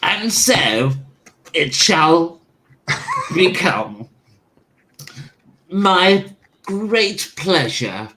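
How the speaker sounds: lightly done, slow Churchill impression; a hint of Churchill's slow gravity; Winston Churchill. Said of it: "It was my attempt at Winston Churchill".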